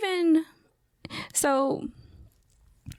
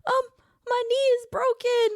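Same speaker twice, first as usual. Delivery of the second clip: high, hammed-up little-boy whine; mock pitiful child voice; imitating crying child. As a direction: play a kid over-dramatizing an injury. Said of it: "he's crying".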